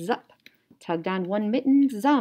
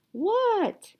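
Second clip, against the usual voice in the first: high What shouted; yelling back; 13 semitones higher than usual. Acted. Froggy yelling back to his mother, high shout. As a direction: call back from outside. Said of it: "yelled Froggy".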